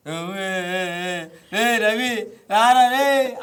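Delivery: loud, drawn-out comic call; Suman Shetty impression. Mimic performing a favourite contestant on request, Suman Shetty impression. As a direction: imitate a comedian's stretched-out holler. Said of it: "ఆయన videos చూసినప్పుడు".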